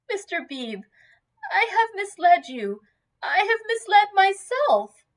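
high, anguished admission, rising in force; distressed confession. A narrator's reading.

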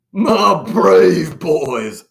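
loud, very drawn-out commander voice; hacking commander. A narrator's reading. Commander addresses his troops, hacking and drawn out. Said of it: "hacked the commander".

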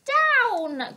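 "Down!" high and loud; witch's cry. A narrator's reading. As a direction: shout "Down!" up high. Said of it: "cried the witch".

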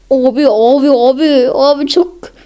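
loud rising alarm calls; loud panicked abi; alarmed shouting. Real, not acted. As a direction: shout in alarm as you're hit hard. Alarm as enemy hits crits.

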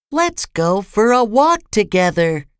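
small, high-pitched suggestion; high little storybook voice; little character voice. A narrator's reading. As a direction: pitch it up, like someone tiny. Tiny character suggests a walk.

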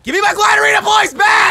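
yelling to get the points back; loud demanding shout. Real, not acted. Fighting over game points.

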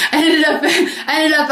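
giggling, pitch up; laughing. Real, not acted. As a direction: laugh through the words at yourself. Laughing at own past.

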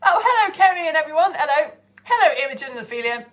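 usual speaking voice

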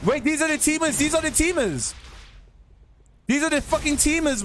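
high, indignant outrage; raging gamer. Real, not acted. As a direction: spit out the complaint. Angry discovery of teamers.

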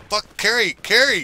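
rising panicked shout of her name; yelling Carrie. Real, not acted. Gamer yelling for teammate Carrie. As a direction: call out to her loud and alarmed.

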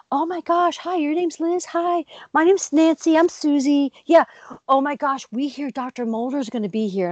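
imitating breathless, high, excited fans; squealing like starstruck women spotting a celebrity. Imitating fans gushing about Doctor Mulder, high and excited. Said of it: "they're going".

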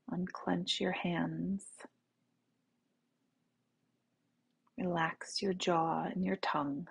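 soft, unhurried meditation voice; guided meditation. Real, not acted. Teacher leading listeners to relax in the right now, soft meditation voice. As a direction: guide them gently into the body, with long pauses.

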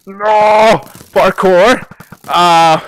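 exasperated shout. Real, not acted. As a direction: yell out in frustration. Fails the parkour.

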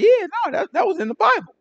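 high, mocking "Yeah, no"; mock know-it-all. Preacher mimics people claiming they always knew, in a high mocking voice. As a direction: parrot the crowd's smug claim high.

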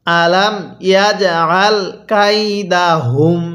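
slow "আলাম ইয়াজ আল কাই দা হুম"; model recitation. Shown as a demonstration. Teacher shows how to read, slow "আলাম ইয়াজ আল কাই দা হুম". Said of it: "কিভাবে পড়ব".

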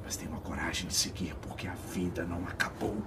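whispering. Acted. Resolve while falling.

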